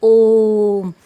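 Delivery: ओम held out long and loud, chanted; sustained chanted om; prolonged om. Shown as a demonstration. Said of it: "जैसे".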